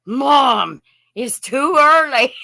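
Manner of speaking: loud whiny pet voice; voicing the dog. Owner voices her dog complaining it's too early.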